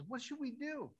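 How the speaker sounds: squeaky-high acted plea; panicked servant. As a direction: jump up high, as a helpless servant asking his master. Servant repeats his alarmed question.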